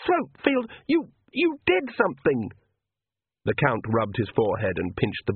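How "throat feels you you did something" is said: high, halting choked accusation; gasped. A narrator's reading. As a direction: break up the words in high-pitched shock. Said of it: "she gasped".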